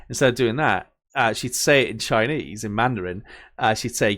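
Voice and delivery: normal talking voice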